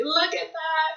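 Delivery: ordinary delivery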